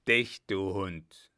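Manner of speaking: slow, loud growl of an attacker; drawn-out, forceful disguised shout. A narrator's reading. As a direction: disguise your voice and call out the insult. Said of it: "mit verstellter Stimme als Antwort rief".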